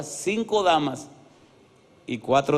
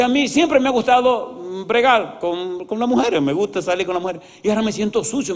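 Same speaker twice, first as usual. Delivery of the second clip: agitated confession, acted; 6 semitones higher than usual.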